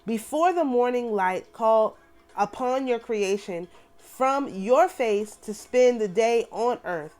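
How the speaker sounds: solemn, measured prayer reading. Acted. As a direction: pray it aloud as scripture. Reads prayer aloud.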